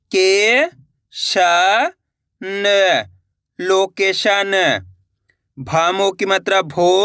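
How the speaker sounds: slow syllable dictation with drawn-out blends; slow spelling. Shown as a demonstration. Teacher drilling students on the o vowel sign, slow and deliberate. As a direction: sound out each piece, then stretch the word.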